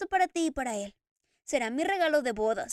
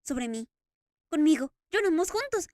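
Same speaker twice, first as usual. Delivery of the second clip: louder, overwhelmed with emotion; almost pleading with joy; tearful Eric; 3 semitones higher, 6 dB louder than usual. Acted. Eric telling the Daroga of Christine's tears, overwhelmed.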